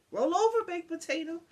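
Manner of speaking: high, loud call to the dog; playful command. A narrator's reading. Old lady commanding her dog to roll over, loud and high. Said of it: "or commanded".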